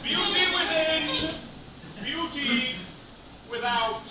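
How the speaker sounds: slow, grand theatrical declaration; dramatic monologue. Acted. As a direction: deliver it like a serious Shakespeare line. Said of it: "Serious dramatic monologue".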